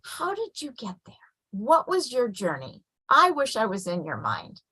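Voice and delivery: soft, tender wondering; hushed, encouraging parent voice; gently curious parent. Retold or imitated. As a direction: ask softly, inviting the child to share. Parent's gentle question retold.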